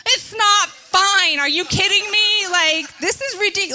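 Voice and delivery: loud, high-pitched indignant protest; hammed-up freak-out, drawing out the first words; exasperated outburst. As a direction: cry out in comic disbelief. Acted inner protest to calm pastor.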